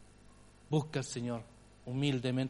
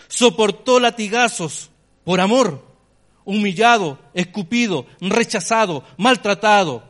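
louder, higher, slow passionate climax; preaching at full force; 4 semitones higher, 9 dB louder than usual. Acted. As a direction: hammer each suffering of the cross.

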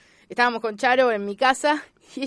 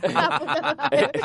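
laughing; much slower than usual. Real, not acted. Laughing at golden console.

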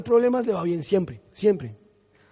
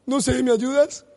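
pleading daughter; 4 semitones higher, slower than usual. Retold or imitated. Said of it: "mi hija viene y empieza llorando".

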